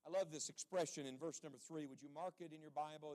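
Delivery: ordinary talk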